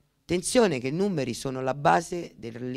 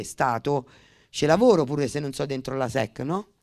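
ordinary talk

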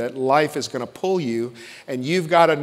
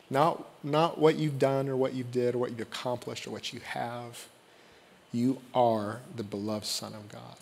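softer, hushed words of blessing; tender, softer blessing spoken over the congregation; 3 semitones lower, 8 dB quieter than usual. Acted. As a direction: speak it gently over each person. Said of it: "when words are spoken over you".

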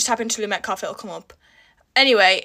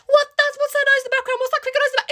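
higher curious mock voice of viewers; imitating viewers; 13 semitones higher, faster than usual. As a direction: mimic nosy viewers, pitched up. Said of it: "everyone who's asking".

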